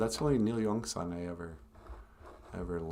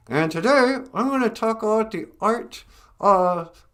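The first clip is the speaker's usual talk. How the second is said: a bit higher, lightly louder presenter; lightly voiced stage host; 13 semitones higher, 9 dB louder than usual. Ventriloquist lecturing kids, a touch higher presenter voice. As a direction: carry on the school talk. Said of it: "I'd be like".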